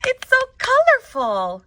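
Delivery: very high, loud gush; delighted exclamation. A narrator's reading. Teacher applauding her class's beach outfits, high delighted gush. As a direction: squeal it out, thrilled. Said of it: "Miss Deaver clapped her hands and said".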